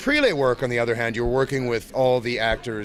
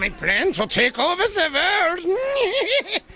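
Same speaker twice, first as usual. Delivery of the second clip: high slow mad scientist cackle; a villain gloating over his world plan; Doctor Wily; 3 dB louder, slower than usual. Acted. Host asks about Doctor Wily, so he does his high slow villain voice. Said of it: "you voiced Doctor Wily".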